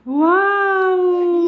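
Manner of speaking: high, loud amazed wow; excited wow. Real, not acted. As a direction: gasp out a thrilled wow. Toy changes colour.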